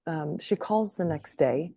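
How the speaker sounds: ordinary talk, nothing marked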